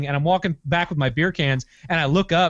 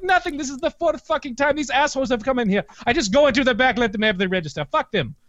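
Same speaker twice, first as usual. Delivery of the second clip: put-on fed-up Iranian accent, pitched up; 7 semitones higher than usual. Clerk explaining repeated robberies, higher mock Iranian accent. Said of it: "I've had that accent stuck in my head".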